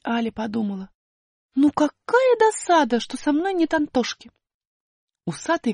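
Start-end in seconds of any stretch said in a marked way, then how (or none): 1.5-4.3 выше, с досадой; Аля жалеет об Антошке; досада. Acted. Аля думает. (высокий голос досады)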